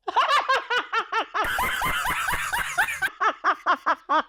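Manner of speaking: high, long burst of disbelieving laughter; real cackling shout-laugh; shrieking laugh. Real, not acted. Reacts to being told off.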